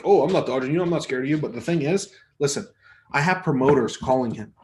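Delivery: ordinary talk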